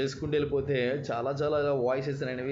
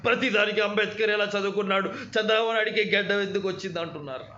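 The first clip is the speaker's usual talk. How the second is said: imitating Srihari, higher and louder; 7 semitones higher, 5 dB louder than usual. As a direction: deliver it like a menacing film villain. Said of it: "నా శ్రీహరి గారి voice".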